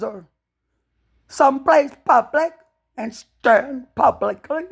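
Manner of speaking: loud, high, slowed furious rant; drawn-out roaring tirade against the king; furious shouting. Real, not acted. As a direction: shout it out at the crown in real rage.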